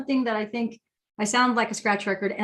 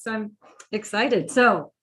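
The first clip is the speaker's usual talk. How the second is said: ordinary talk, nothing marked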